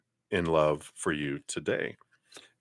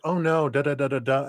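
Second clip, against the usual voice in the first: mock protest; 7 semitones higher than usual. Imitates people's reply to her joke.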